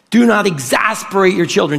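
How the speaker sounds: ordinary delivery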